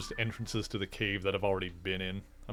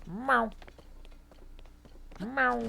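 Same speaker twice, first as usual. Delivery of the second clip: high squeaky meow imitating the cat; 14 semitones higher than usual. For the viewers, imitating his cat, a high meow. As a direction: mimic the cat's high meow. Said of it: "You're saying".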